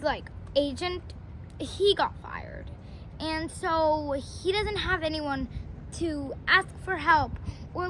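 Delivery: ordinary delivery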